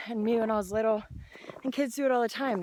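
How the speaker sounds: ordinary delivery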